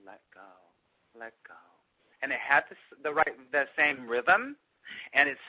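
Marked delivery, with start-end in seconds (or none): 0.1-1.5 very soft, spaced-out repetition; soft mantra. Retold or imitated. Meditator sitting on the street repeating a new mantra, softly. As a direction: breathe it out quietly like a meditation. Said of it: "I started saying".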